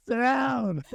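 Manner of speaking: put-on high bossy kid voice. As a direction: bark it like a little kid. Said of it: "a kid who's like".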